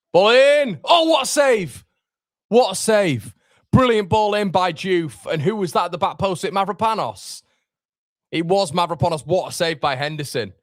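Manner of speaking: high, loud burst of live match excitement; excited commentary. Real, not acted. Watch-along host reacting live to a brilliant save, excited commentary. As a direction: shout the big save, then ease down, still high.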